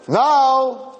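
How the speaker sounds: high contradicting mock cry; arguing old porch-sitter. One old porch-sitter contradicting another, high mock cry. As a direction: cut in with a high, put-on objection. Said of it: "they're all fighting there".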